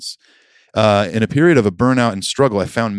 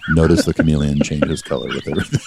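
nature documentary; 6 semitones lower than usual. Retold or imitated. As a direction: lower documentary narrator voice. Mocking nature documentary narration.

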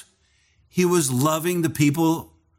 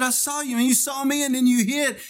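insistent; 10 semitones higher than usual. Retold or imitated. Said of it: "I said".

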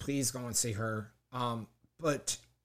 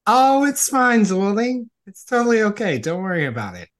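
gushing reassurance; 8 semitones higher, 15 dB louder than usual. Real, not acted. Reassuring guest about her mic.

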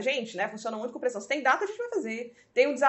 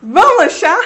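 "Vamos lanchar?" much louder and higher in disbelief; incredulous repeat; 6 semitones higher, 17 dB louder, slower than usual. Retold or imitated. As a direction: belt out "Vamos lanchar?" as if it's absurd. ("Vamos lanchar?" bem alto, incrédula)

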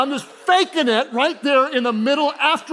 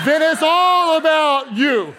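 higher, louder, slowed; loud punchline; 6 semitones higher, 5 dB louder, slower than usual. Real, not acted. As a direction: land the joke hard.

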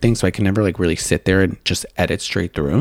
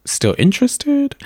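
sweet high up-talk; slower than usual. Retold or imitated. Acted sweet message ending.

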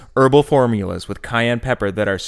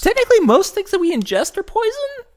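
much higher silly voice. Acted. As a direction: say it in a high silly voice. Joking voice.

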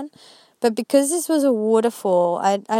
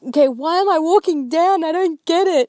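high, loud, exasperated plea to God; 5 semitones higher, 4 dB louder than usual. Retold or imitated. A woman retelling her confused prayer on the hike, exasperated and loud. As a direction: voice climbing in disbelief, pleading.

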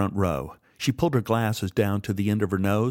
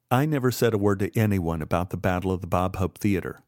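ordinary delivery

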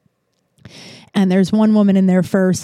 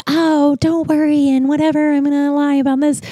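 high, sustained put-on voice; mock reassurance; 6 semitones higher than usual. Woman promising not to tell about the toilet, mock-sweet. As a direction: mimic her false comfort in a raised sing-along tone. Mocking imitation of two-faced woman.

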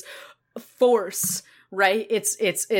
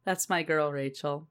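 tender pride; 6 semitones lower, 9 dB quieter than usual. Retold or imitated. Cassie's fond pride.

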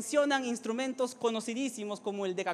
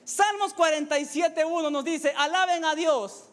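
raised, emphatic sermon delivery; impassioned preaching; 6 semitones higher, 5 dB louder than usual. Acted. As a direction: proclaim it to rouse the congregation. Preaches at full force.

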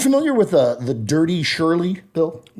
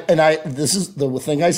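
ordinary talk

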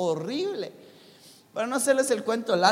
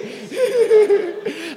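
high burst of laughter; loud laugh; 8 semitones higher, 7 dB louder than usual. Real, not acted. Preacher laughing at his friends' useless advice, loud high laugh.

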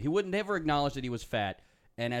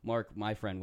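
ordinary talk

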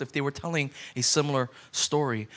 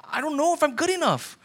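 unsure believer; 10 semitones higher than usual. Retold or imitated. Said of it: "your first question was like".

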